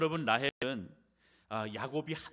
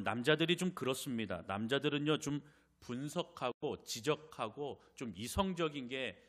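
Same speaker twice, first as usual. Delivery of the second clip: usual speaking voice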